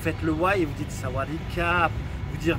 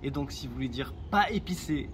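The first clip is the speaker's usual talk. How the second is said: ordinary talk, nothing marked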